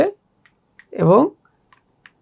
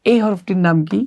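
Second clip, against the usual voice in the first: ordinary talk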